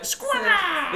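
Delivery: high squawking cry; bird screech. Retold or imitated. Imitating a creature cry.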